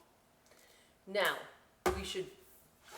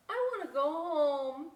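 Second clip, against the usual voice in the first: put-on whiny kid voice; 8 semitones higher, 6 dB louder than usual. Little Bat homesick.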